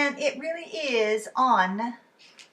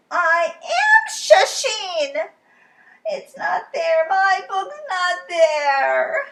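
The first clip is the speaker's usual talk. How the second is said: slow, high, loud teary wail; a child near tears; wailing Lola; 9 semitones higher, 11 dB louder, much slower than usual. Acted. Lola wailing to Charlie, slow and teary.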